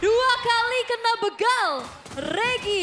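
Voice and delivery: high, loud, slow excited call; excited shout. Real, not acted. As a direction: shout it out as the play lands. Caster reacting to a double pick-off.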